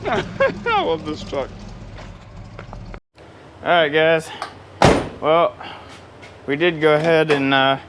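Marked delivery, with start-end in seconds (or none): 0.1-1.5 slow, high, through laughter; laughing. Real, not acted. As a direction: gush it out laughing. Laughing at the repair.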